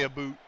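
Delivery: "a boot" with a long oo vowel; exaggerated Canadian "about". Shown as a demonstration. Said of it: "She doesn't say".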